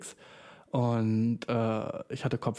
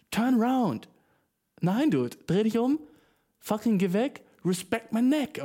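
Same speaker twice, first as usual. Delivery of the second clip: mock angry retort; 9 semitones higher than usual.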